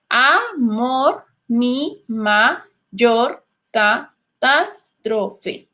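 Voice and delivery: very slow, each syllable of "mi mayor catástrofe" split apart. Shown as a demonstration. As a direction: count out every syllable slowly. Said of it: "Primero los debemos separar en sílabas". (muy lento, separando cada sílaba)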